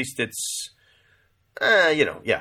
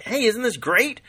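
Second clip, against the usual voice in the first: much higher, eager hey isn't this great; excited mock question; 13 semitones higher than usual. Husband to crying wife at the movies, eager and high. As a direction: chirp it up like a thrilled date. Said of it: "I'm like".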